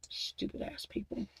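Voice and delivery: breathy whispered curse under her breath; fed-up whisper of an insult. Real, not acted. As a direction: hiss it under your breath in disgust. Angry rant, muttered aside.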